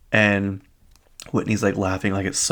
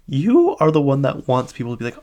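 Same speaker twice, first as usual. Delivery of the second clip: higher, louder exasperated comeback; indignant retort; 5 semitones higher, 5 dB louder than usual. Retold or imitated. Viewer calling out the self-made victim, indignant. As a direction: push back hard, pitched up. Arguing back at the reality star.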